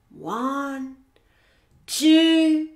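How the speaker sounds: suspenseful count rising toward the pounce; building countdown. Acted. Hippo ready to pounce, slow countdown building in excitement.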